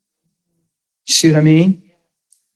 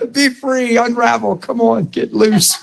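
higher, teasing urging to loosen up; a higher, playful encouragement; playful coaxing; 7 semitones higher than usual. Real, not acted. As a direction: coax them to let go, half laughing.

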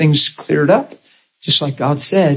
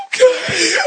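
high mock-sobbing squeak; imitating bawling. Crying man agrees to come pray, high mock sob. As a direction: squeeze it out through pretend tears. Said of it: "starts bawling uncontrollably".

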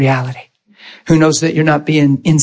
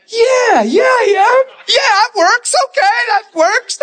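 very high, squeaky agreement; mock high squeal; slower than usual. Preacher thinking about the yelling, mock high squeal. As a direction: squeal along in an exaggerated high voice. Acting own thoughts at man's yelling.

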